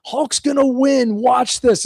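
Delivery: put-on high, slow knowing older brother; lightly voiced smug brother. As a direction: tease like a smug big brother. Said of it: "He goes".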